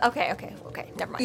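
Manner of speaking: hushed backpedal, fading to almost nothing; mock meek retreat. Acting out Harry backing off.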